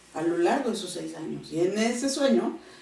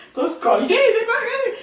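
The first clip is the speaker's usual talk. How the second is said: much higher, laughing and excited; 14 semitones higher, 6 dB louder than usual. Real, not acted. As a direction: rush it out, thrilled and laughing. Excited retelling of running down. (emocionada, mucho más aguda y riendo)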